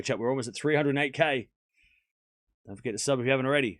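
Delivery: ordinary talk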